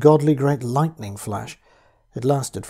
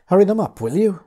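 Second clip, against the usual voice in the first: higher and louder, urgent; near shout; 7 semitones higher, 7 dB louder than usual. Acted. Narrator urging Pritchard to fetch family, near shout. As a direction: call it out, almost shouting. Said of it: "I almost shouted".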